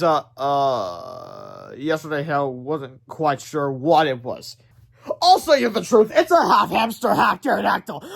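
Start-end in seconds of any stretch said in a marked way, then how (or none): 5.2-8.0 higher, louder figure voice; character voice. Acted. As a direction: explain in character. Character explains creature.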